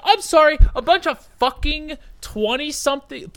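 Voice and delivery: high, loud sarcastic complaint; mock-apologize with outrage; ranting at the teacher, loud and sarcastic; loud indignant sarcasm. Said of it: "And I was like".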